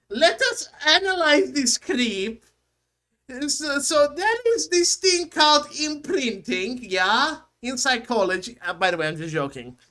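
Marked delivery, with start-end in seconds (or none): 0.1-8.6 mock German analyst, higher and louder; high, loud, hammed-up German voice; German accent. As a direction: lecture in a put-on German accent. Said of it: "let me put in my German voice".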